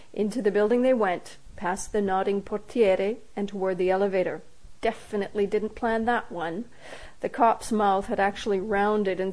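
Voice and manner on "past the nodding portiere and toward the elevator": ordinary delivery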